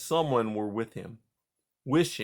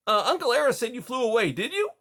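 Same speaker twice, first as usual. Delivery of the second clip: high, fast, breathless little voice; eager young mouse; 12 semitones higher, 5 dB louder, faster than usual. Acted. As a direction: tumble out the question.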